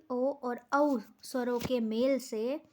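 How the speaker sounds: ordinary delivery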